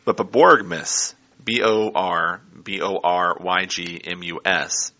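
Baborgmus said slowly, then spelled letter by letter. Shown as a demonstration. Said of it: "it was a word of the day".

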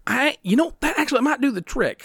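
high-pitched character reply; high voice, having an idea; game character. Acted. GM replies in character.

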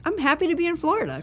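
bright, quick, upbeat quote; chirpy holiday cheer; cheerful. Retold or imitated. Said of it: "and I'm like".

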